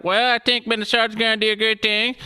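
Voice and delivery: mock the Minnesotan accent back. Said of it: "what that accent was".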